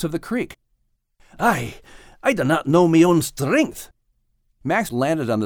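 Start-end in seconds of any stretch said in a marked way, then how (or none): 1.4-3.9 lightly Scottish dog Max, pleased with himself; a hint of a proud Scottish dog; gentle Scottish brogue with a little boast; Max's Scottish voice.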